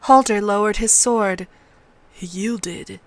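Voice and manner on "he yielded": soft, subdued agreement; hushed echo of a comrade's words. A narrator's reading. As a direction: repeat it quietly, conceding. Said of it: "he echoed".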